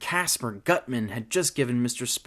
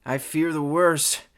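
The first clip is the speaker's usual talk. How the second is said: raised, doom-laden groan; 6 semitones higher, 4 dB louder than usual. Acted. Petey expecting the worst in the cage, groaning. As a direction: groan it in dread. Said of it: "groaned Petey".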